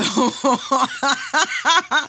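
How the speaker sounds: high, loud laugh. Real, not acted. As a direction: break into laughter. Laughing about the accent.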